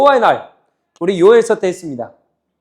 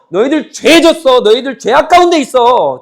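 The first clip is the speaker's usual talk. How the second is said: loud, slow, stern rebuke; slow, raised prophetic condemnation; stern accusation; 6 semitones higher, 8 dB louder than usual. Retold or imitated. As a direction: hammer home the charge of sin. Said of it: "하나님께서 또 뭐라 그러세요".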